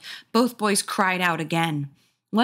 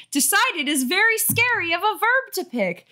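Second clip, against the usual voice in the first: mock dramatic; 12 semitones higher than usual. Real, not acted. Mock-scared reader comment.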